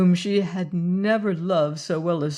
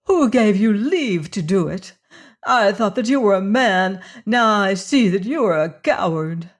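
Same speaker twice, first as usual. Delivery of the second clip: outraged demand, much louder; furious; 2 semitones higher, 7 dB louder than usual. Acted. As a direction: hurl the question at him. Furious woman confronts Leonard.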